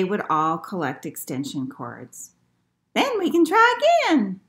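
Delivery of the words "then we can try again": put-on very high, loud old-lady voice; high-pitched storybook old lady; little old lady. A narrator's reading. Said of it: "said the little old lady".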